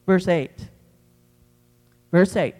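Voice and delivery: calling out verse. Real, not acted. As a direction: call out the verse number. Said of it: "announcing verse".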